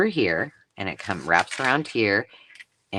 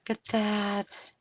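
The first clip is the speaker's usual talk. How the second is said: amazed; 11 semitones higher, 6 dB quieter, slower than usual. Real, not acted. Marvelling at the lettering.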